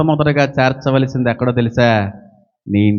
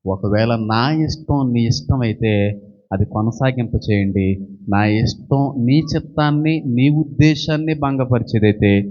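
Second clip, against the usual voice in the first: earnest prayer; 7 semitones lower than usual. Acted. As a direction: pray it humbly and low. Young person's prayer voiced. (తక్కువ స్వరంతో వినయపూర్వక ప్రార్థన)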